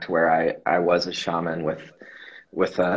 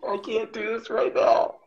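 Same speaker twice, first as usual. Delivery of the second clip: laughing disbelief. Retold or imitated. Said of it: "I was like".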